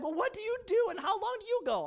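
a hint of a high defensive friend; lightly voiced friend pushing back; friend's retort. Friend asking the pastor back, lightly voiced challenge.

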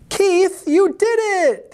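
very high, thrilled cheer; jubilant, squealing praise. A narrator's reading. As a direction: cheer for a friend in a high cat voice. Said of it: "the other cats cheered".

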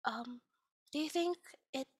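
a hint of a shy, hopeful villager; lightly voiced timid asking. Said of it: "another one comes up to the traveler and says".

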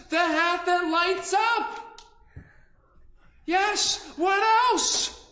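loud, high, delighted calling; overjoyed, greeting each hat; joyful. Acted. Peddler overjoyed as each of his hats comes back, loud and high.

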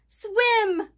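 high, loud one-word yelp; lightly voiced otter barking an order. A narrator's reading. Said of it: "barked Otter".

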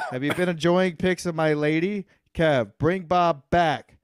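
normal talking voice

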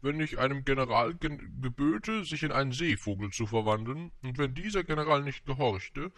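high-pitched stately royal voice; storybook monarch's elevated lecture; pompous king, reasoning. A narrator's reading.

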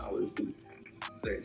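usual speaking voice

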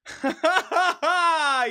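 high, elated laughter; high cackle of joy; triumphant laugh. Real, not acted. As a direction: burst into a real victory laugh. Laughing in triumph after success.

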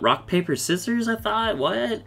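Alchemist. Retold or imitated. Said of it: "Alchemist points out".

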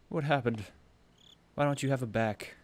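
normal talking voice

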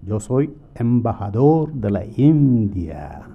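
slow, loud mock-accented Spanish; different accent. Crook posing as ambassador to bus passengers, mock accent. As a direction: stretch the words out in a put-on accent. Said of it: "talks in a different accent".

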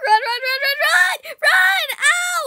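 loud high panicked yells; shouting in panic. Real, not acted. Player fleeing the angry mother, loud panicked yells. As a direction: yell at yourself to run.

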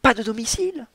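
high, loud indignant outburst; indignant cry. Acted. Balthazar protesting to the shopkeeper, loud and indignant. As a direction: bristle and cry it out. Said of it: "s'écria Balthazar, qui se rebiffait".

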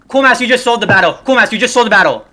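high, loud accusation; angry shout. Real, not acted. Gamer berating teammate for selling the battle, angry shout. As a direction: yell at the teammate who blew it.

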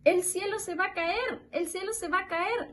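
high storybook alarm cry; alarmed hens. A narrator's reading. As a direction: chant the warning high, without yelling. Hens repeat their alarm in story. (gallinas alarmadas, voz muy aguda)

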